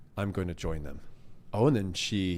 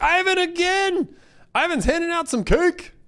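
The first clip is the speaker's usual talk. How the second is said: excited; 5 dB louder than usual. Real, not acted. Second donation from Ivan.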